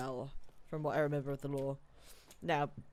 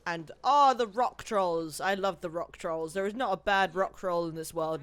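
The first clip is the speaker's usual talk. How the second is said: loud, emphatic fondness; gushing; 2 semitones higher, 9 dB louder than usual. Real, not acted. Player raving about favourite rock trolls, gushing. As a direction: gush loudly, hitting the key words.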